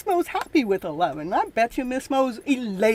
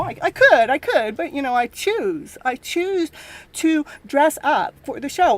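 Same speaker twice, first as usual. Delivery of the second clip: ordinary delivery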